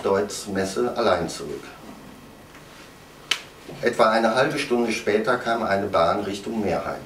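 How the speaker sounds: low, quiet, detached narration; sober report. A narrator's reading. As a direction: read the facts coolly, like a case file. Closing report read by narrator.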